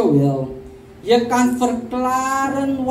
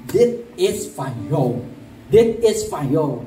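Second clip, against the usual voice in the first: lower-pitched, deliberate handing over; lightly voiced giver; 7 semitones lower than usual. Preacher quoting sister Gladys giving food, lightly voiced giver. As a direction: lightly act out presenting a gift, low.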